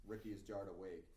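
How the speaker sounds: ordinary talk, nothing marked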